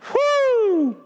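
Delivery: sudden high whoop of excitement; joyful falsetto woo; excited woo. Real, not acted. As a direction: let out a thrilled whoop. Preacher cheers at own point.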